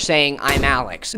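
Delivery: usual speaking voice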